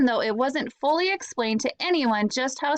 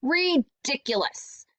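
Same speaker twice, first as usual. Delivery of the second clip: grumbled; 3 semitones higher, slower than usual. Acted. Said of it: "Evan grumbled".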